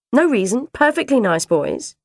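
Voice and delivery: high, loud brush-off; dismissive dinner lady. Acted. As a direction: wave it away, stressing perfectly.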